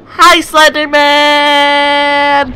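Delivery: very high, loud, long-drawn holler; mock greeting yell. Gamer greeting Slenderman in the game, high drawn-out mock yell. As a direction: stretch the last syllable out in a high, playful yell.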